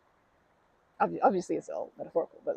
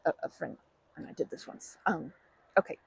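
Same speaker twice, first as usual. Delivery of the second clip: normal talking voice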